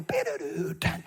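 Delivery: ordinary delivery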